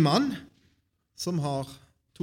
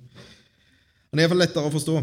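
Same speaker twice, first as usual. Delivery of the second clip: usual speaking voice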